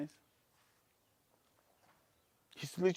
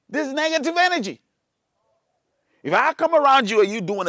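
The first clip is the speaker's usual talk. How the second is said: high, loud, stretched phrases; impassioned preaching; 8 semitones higher, 10 dB louder than usual. Acted. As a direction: preach it hard, rising to the last words. Preaches at full force about negativity.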